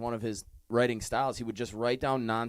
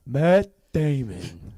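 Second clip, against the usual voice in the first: slow, drawn-out movie-puppet impression; louder, stretched-out mock name-call; Matt Damon puppet; 6 dB louder, much slower than usual. Host quoting Team America, mock puppet voice.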